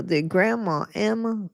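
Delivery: usual speaking voice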